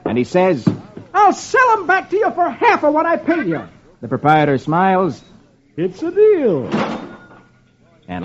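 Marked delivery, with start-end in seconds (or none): 1.1-3.6 high-pitched comic husband; fed-up Dagwood. A narrator's reading. Fed-up Dagwood returns parrot. 5.8-6.7 high, pleased dealer; shop proprietor. A narrator's reading. Proprietor accepts the deal.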